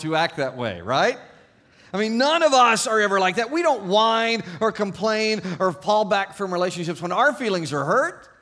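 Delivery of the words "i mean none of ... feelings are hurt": loud, high ironic protest; sarcastic, overblown indignant denial; mock denial. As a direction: protest far too much, loudly. Mock denial after joke about five-year-olds.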